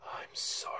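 slow, soft creepy imitation sinking to a whisper. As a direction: draw out each apology quietly, then whisper it. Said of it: "it's really creepy. And she's like".

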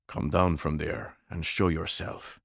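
a moneyed Russian aristocrat giving an order, pitched low; deep Russian warlord. Acted. Warlord orders Mist down from her window, low Russian accent. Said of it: "Deep voice, Russian accent, moneyed, aristocratic".

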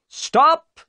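loud, high police shout; the inspector shouting at the fleeing boat, loud and high. A narrator's reading. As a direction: bellow it across the water. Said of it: "he cried".

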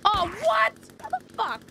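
high, loud startled shout; real startled yell of disbelief; shocked outburst. Real, not acted. Sudden shock in game.

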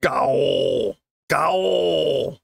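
monster roar. Acted. Costumed monster roaring at the frightened kids, mock roar. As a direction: roar like a pretend monster, not too scary. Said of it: "a monster roar".

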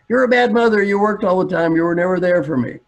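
put-on high, loud teenage complaint; acted indignant daughter; accusing daughter. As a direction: hurl it like an aggrieved teenager.

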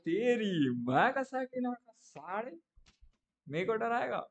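high, drawn-out mock threat; defiant retort. Dreamer daring the ghost who came to scare him, defiant retort.

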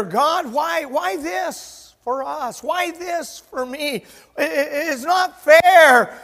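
a rising complaint that breaks out on "not fair"; plaintive plea. Retold or imitated. A preacher voices believers complaining to God, high and pleading.

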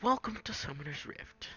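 high, announcer-style welcome; a game announcer greeting players, in his own voice. Shown as a demonstration. Vlogger demoing his announcer pack, announcer-style greeting. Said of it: "in my own voice".

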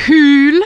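Hül with a loud breathy H. Shown as a demonstration. Teacher models Hül with an aspirated H. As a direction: push the H in Hül. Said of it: "entendez la différence".

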